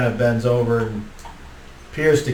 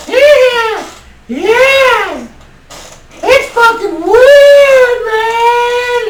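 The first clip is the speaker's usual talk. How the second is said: very high, loud, slow squeal; squeaky half-gnome; 11 dB louder, much slower than usual. Acted. Half-gnome creature agreeing with the crowd, squeaky and loud. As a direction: squeak it out like a weird little goblin.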